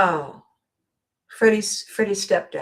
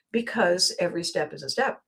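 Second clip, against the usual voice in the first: normal talking voice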